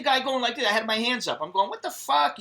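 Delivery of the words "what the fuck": high-pitched, incredulous challenge; indignant disbelief. Retold or imitated. Bystander challenging a cop siding with police, indignant.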